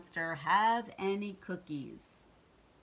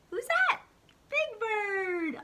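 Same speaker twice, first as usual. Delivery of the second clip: playful question; 6 dB louder, slower than usual. Real, not acted. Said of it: "reader asks child".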